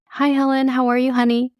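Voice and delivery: high, sweet auntie voice; imitating a sweet auntie; sweet, calm greeting. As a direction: greet her like a doting aunt. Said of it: "so sweet and so calm".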